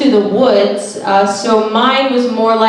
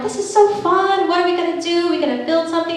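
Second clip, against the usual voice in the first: high, bubbly excited voice; 7 semitones higher, 4 dB quieter, faster than usual. Retold or imitated. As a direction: gush like a thrilled date. Quoting her excited self.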